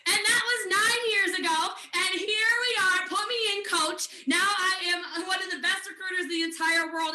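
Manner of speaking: loud, high, fired-up delivery; triumphant boast. Real, not acted. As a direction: raise pitch and volume like an eager player. Bursts into triumphant recruiting boast.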